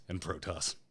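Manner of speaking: suddenly low and soft; low, quiet tag-on; dropped aside. Shown as a demonstration. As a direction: drop right down after the big shout. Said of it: "and you drop it".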